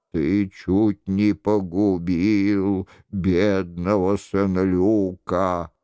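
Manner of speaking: low, slow, solemn reproach; a solemn accuser from the dark, drawn out; stern mysterious voice. Acted.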